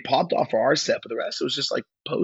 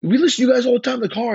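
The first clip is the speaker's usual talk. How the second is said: fast high gushing; gushing fan; 12 semitones higher, 5 dB louder, much faster than usual. Retold or imitated. Rock star gushing to the band, fast high excitement. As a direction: rattle it off high and thrilled. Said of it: "he's like".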